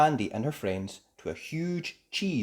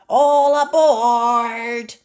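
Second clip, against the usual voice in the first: very high, loud, stretched call; 14 semitones higher, 14 dB louder, much slower than usual. Acted. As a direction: call everyone onto the boat. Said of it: "called Mandy".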